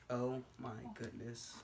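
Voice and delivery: softly spent oh my goodness; quiet exhausted sigh. Real, not acted. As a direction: breathe it out, worn out. Exhausted after silly sounds.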